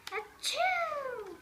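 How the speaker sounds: high, loud achoo; a sudden cartoon sneeze. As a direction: sneeze it out big. Gerald sneezes again.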